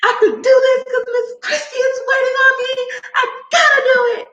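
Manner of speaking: high, whimpering mock cry; hammed-up tearful whine about having to edit; mock whiny crying. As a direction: whine like you're about to cry over work. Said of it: "I be like".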